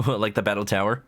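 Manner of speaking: normal talking voice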